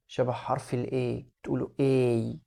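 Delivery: "A" said as the letter name, long ay. Shown as a demonstration. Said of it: "الحرف بيتنطق باسمه".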